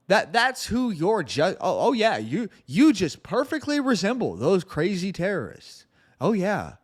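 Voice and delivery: high, swooping mock agreement; very sarcastic. Real, not acted. Host tells listeners they resemble terrorists, dripping sarcasm. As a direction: lay the sarcasm on thick. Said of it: "I'm being very sarcastic".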